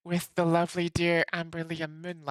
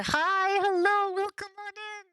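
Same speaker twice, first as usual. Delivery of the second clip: sing-song high greeting; high-pitched greeting; 14 semitones higher than usual. Acted. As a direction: chirp the welcome up high. High cheerful stream greeting.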